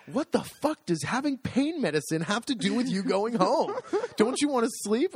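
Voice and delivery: high-pitched fed-up nurse, retold; mock outburst with pitch swooping up; exasperated cursing. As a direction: scold a stubborn patient in disbelief. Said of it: "she cursed at me".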